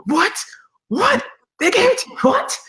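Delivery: very high-pitched, astonished squawk; imitated disbelief. Pastor hearing of the job offer by phone, mock astonishment.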